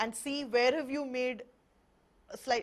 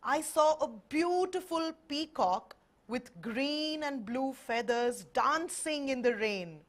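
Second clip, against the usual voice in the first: slow demo sentence; 2 semitones higher, slower than usual. Shown as a demonstration. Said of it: "practice saying the sentence".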